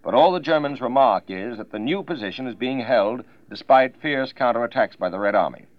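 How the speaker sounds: 1940s radio news read; crisp wartime bulletin delivery; old newsreel. Acted.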